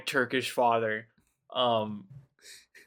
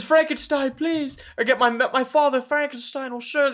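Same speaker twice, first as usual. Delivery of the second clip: put-on pleading child; 12 semitones higher, 5 dB louder than usual. Acting the child begging Victor.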